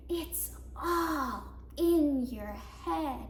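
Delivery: very slow, drawn-out words; patronizing dismissal. Acted. Others answering an upset woman, slowly patronizing. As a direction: stretch each word out as if soothing a fool. Mimics what upset women are told.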